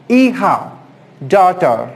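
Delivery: ordinary delivery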